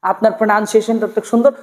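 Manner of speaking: usual speaking voice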